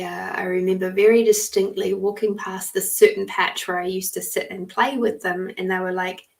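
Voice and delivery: ordinary talk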